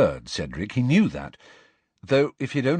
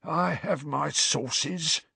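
slow, smug hiss of a caretaker; self-satisfied hiss; 7 semitones higher, much slower than usual. Acted. Caretaker smugly refusing to name his informer, slow hiss. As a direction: savour every word smugly. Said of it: "in a self-satisfied hiss".

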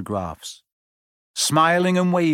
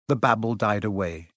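usual speaking voice

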